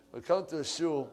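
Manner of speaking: usual speaking voice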